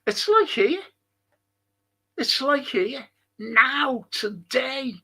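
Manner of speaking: a quick high-pitched echo, curious; high surprised echo. Real, not acted. Echoing the remark, surprised.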